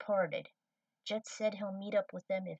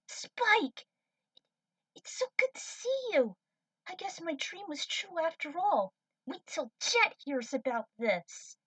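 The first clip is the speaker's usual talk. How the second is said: much higher and louder, bursting with joy; young cadet thrilled to see Spike alive; overjoyed cry; 9 semitones higher, 5 dB louder than usual. Acted. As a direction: squeal his name in delight.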